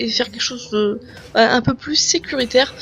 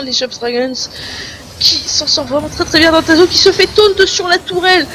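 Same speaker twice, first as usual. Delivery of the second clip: excited esports play-by-play; esports caster; 4 semitones higher than usual. Real, not acted. Live play-by-play climax.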